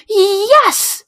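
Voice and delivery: high, loud, delighted yes; child's jubilant outburst; triumphant cheer. Acted. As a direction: cheer like a thrilled kid. Child delighted by free dinosaurs.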